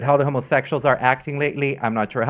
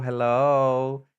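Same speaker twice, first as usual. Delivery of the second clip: ordinary delivery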